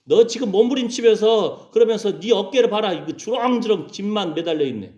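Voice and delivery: mock stern dressing-down; acted scolding. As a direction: tell them off sternly. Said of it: "그러면서 호통을 치는 거예요".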